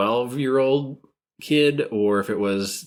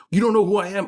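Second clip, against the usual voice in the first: mock indignant; 11 semitones higher, 4 dB louder, faster than usual. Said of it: "when they say".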